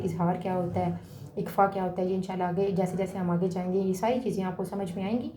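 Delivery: ordinary talk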